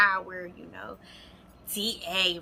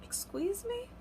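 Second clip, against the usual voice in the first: much higher shocked squeak; 12 semitones higher, 10 dB quieter than usual. Real, not acted. Woman hearing boyfriend's confession, shocked. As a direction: react in total disbelief.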